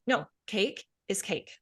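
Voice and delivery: flat, slow robotic mantra. Shown as a demonstration. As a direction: state it like a machine, no feeling. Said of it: "like, robotic".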